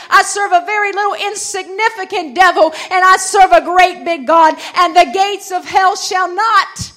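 loud, emphatic revival sermon; full-force preaching. Real, not acted. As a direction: drive each phrase home to the congregation.

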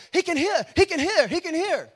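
loud, high, excited repeated cries; losing his mind. Retold or imitated. Preacher imitating the healed man's friend, losing his mind with excitement. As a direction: shout it out like an amazed friend. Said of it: "he loses his mind, and his friend's going".

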